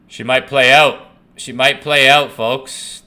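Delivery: loud, high hopeful cheer; willing the chart up, loud and high; excited hope. Real, not acted. Hoping the trade bounces back.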